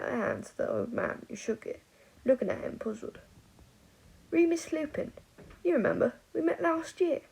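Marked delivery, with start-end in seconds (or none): 4.3-7.2 put-on posh English accent. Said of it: "affecting an accent".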